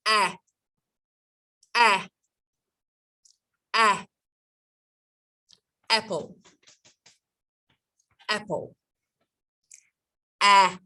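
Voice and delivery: exaggerated teaching demo of ah and apple; exaggerated apple sounds. Shown as a demonstration. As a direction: bite into the ah, stretching apple. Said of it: "bite the apple".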